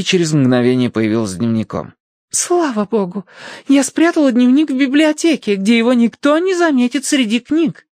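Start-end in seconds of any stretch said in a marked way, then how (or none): 2.3-7.9 put-on high-pitched girlish gush; relieved girl. A narrator's reading. Daughter has found her diary safe, relieved.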